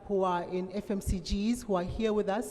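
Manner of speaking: ordinary talk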